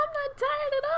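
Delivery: mock perky.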